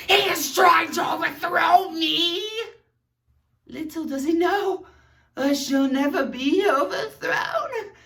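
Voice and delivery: hammed-up king. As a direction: declare victory like a melodrama king. Villain-king declaration example.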